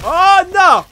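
high, loud cry of alarm; alarmed shout. Real, not acted. Player caught off guard by a creature, shouting in alarm. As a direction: shout it as something jumps at you. Jumpscare in the game.

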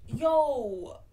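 loud, drawn-out "Yo"; retold disbelief. Woman reacting to her date's unwelcome move, acted disbelief.

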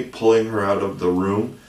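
ordinary delivery

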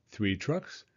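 ordinary delivery, nothing marked

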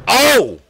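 high, loud "oh"; sudden shriek of surprise; startled scream. Real, not acted. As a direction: yelp at a jump scare. Jump scare in the game.

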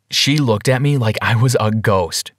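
ordinary delivery, nothing marked

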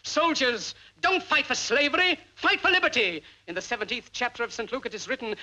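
usual speaking voice

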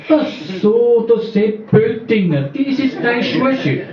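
high, slowing, amazed; surprised realisation. Acted. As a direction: colleague suddenly realises who he is. Colleague realises who Schulsche is.

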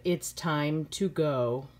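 ordinary delivery, nothing marked

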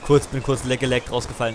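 ordinary talk, nothing marked

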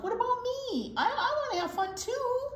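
high-pitched, left-out protest; plaintive cry. A narrator's reading. Skunk wants fun on his birthday, plaintive high cry. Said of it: "cried Skunk".

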